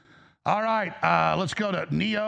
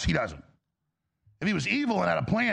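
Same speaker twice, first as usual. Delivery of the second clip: normal talking voice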